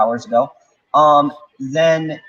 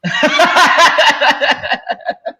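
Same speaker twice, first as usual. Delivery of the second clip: laugh; 13 semitones higher, 6 dB louder than usual. Real, not acted. Long laugh after joke.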